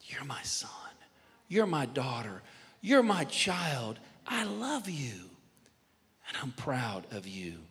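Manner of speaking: almost whisper it, leaving long pauses; hushed blessing. Acted. The Lord saying it to listeners today, hushed tender blessing.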